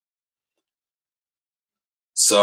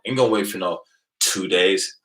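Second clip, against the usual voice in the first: ordinary talk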